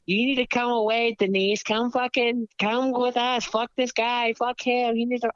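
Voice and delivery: high, bratty imitation of her friends; mocking girlfriends. Friends pulling Denise away, mocked in high whine. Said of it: "her friends finally come over, like".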